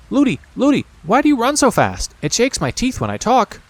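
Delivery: put-on high little-girl voice; child princess pleading, high; princess's voice. A narrator's reading.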